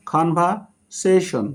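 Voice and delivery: "Conversation" slowly in chunks, conveyor, then session; teacher slowly dividing "conversation" into its parts; broken into syllables. Shown as a demonstration. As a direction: pause inside "conversation" before session. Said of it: "শব্দকে ভেঙে ভেঙে উচ্চারণ".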